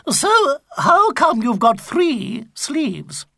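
a baffled granny asking; puzzled old lady. Acted.

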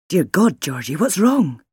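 loud, startled question with big pitch swings; alarmed. A narrator's reading. Husband jolted awake by his wife's scream, alarmed. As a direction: cry it out in urgent alarm.

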